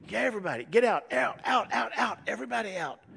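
high, loud, repeated command; urgent shouted order. Retold or imitated. Joseph kicks everybody out of the room, acted urgent shout.

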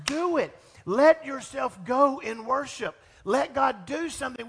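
loud high slow appeal; rally the room to let go; fervent urging. Real, not acted. Urging congregation to worship.

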